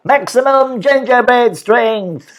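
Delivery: high, slow tiny hero cry; loud, high battle cry; little biscuit shout. A narrator's reading. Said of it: "shouted the little biscuit".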